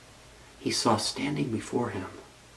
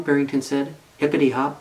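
ordinary delivery

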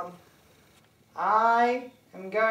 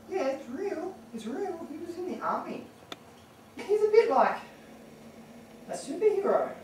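high sweet voice; 8 semitones higher than usual. Real, not acted. Sweet high voice on phone to mum.

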